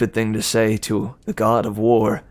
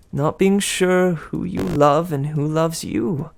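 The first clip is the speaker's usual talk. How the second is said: higher, sweet gossiping goddess; Aphrodite; 6 semitones higher, slower than usual. Acted. Aphrodite teasing Percy about Annabeth, higher and sweet. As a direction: coo it like a doting goddess.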